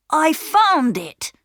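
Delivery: put-on proud boy. A narrator's reading. Peter shows his sisters the coal, proudly. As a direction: show off the find. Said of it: "said Peter".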